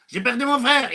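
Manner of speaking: high plaintive lament; hammed-up grief; mock whining. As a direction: moan about it like a sulker. Mock complainer listing losses.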